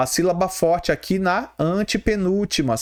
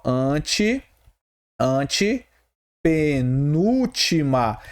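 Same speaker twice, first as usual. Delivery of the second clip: "antepenúltima" very slow, broken after "ante"; syllable-by-syllable demo; 2 semitones lower, much slower than usual. Shown as a demonstration. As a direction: stretch "antepenúltima" out in separated chunks for the class. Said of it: "olha só".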